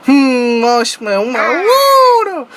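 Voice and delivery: mock door-opening entrance in a silly voice; Big Les. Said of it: "like fucking Big Les".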